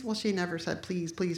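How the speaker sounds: ordinary talk, nothing marked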